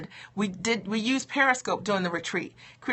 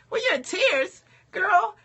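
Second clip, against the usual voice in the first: high teasing delight; 9 semitones higher than usual. Real, not acted. Teasing a viewer in tears.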